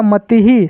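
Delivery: "मति ही" ending on a distinct hee; visarga echoing vowel. Shown as a demonstration. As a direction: add hee after ti in "मति ही". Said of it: "ही का उच्चारण करना है". ("मति ही" के अंत में ही)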